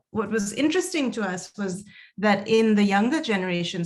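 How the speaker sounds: usual speaking voice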